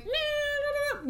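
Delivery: high sing-song nah nah; mock dismissive nurse. Imagined nurse waving away covid, high sing-song. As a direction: brush it off in a high voice.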